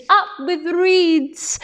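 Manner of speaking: high, slow, long-held hoot; drawn-out high chant. A narrator's reading. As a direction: cheer it as a hooting duckbill. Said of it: "And they hooted".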